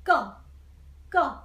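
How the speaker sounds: "go" starting high and dropping hard; falling fourth tone. Shown as a demonstration. Teacher likens fourth tone to saying go, sharp high fall. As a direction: say "go" like a firm command, falling. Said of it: "Like you say".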